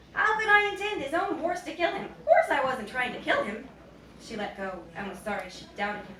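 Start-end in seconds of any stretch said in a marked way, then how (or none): 0.1-3.7 high, loud, wounded denial; indignant protest. A narrator's reading. Son protesting innocence to his mother, indignant. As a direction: protest it like an accused boy.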